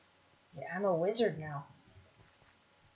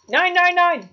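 high, loud repeated refusal; mock German shouting; 9 semitones higher, 11 dB louder, slower than usual. Player punning on nine left, mock German yell.

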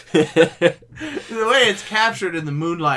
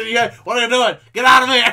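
yelling at someone on screen; shout, surprised and angry; 5 semitones higher, 4 dB louder than usual. Pretend. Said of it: "in the sound, just".

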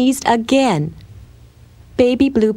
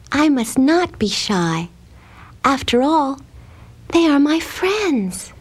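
put-on higher, slow little-girl voice; shy girl's resolve; 7 semitones higher than usual. A narrator's reading. Shy Blueberry persuades herself to invite her friends, slow higher girl voice. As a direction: slowly talk yourself into it, pitch climbing.

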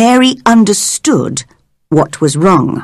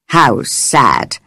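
lower, mild sadness; sad; 7 semitones lower, 3 dB quieter than usual. A narrator's reading. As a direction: say it sorrowfully, lower. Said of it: "said one".

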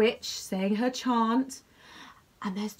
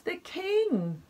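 grand announcing; 9 semitones higher than usual. Acted. King's title said with flourish.

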